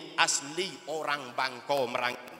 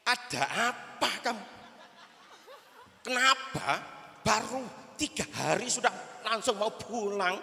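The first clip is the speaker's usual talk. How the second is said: higher, amused kiai asking the student; hint of a chuckling kiai; 6 semitones higher than usual. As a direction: tease the homesick santri as the kiai. Kiai questions crying student.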